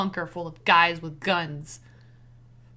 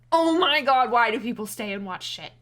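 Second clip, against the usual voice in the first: exasperated; 2 semitones higher, 8 dB louder than usual. Real, not acted. Exasperated exclamation.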